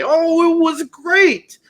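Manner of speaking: high, drawn-out fake enthusiasm; mock gushing. Mimicking gushing moviegoers' reaction.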